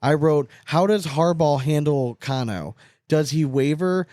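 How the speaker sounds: ordinary talk, nothing marked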